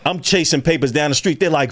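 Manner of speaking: ordinary delivery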